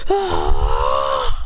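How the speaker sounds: long strained "ah"; drawn-out battle cry; attack yell. Acted. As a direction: let out a held effortful yell. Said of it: "As he goes".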